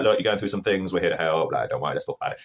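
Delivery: low, easygoing reassurance; chill uncle. Acted. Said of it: "his uncle's just chill with it".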